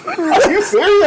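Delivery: high, drawn-out incredulous laugh; stretched-out question through laughter; laughing disbelief. Real, not acted. Laughs at friend's Golden Girls confession.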